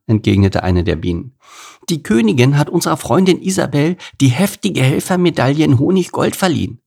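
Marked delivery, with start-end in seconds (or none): 1.9-6.8 higher, lightly voiced bee; a small bee proudly reporting the news; lightly voiced cheerful bee; proud little bee. A narrator's reading. A bee answering Bert.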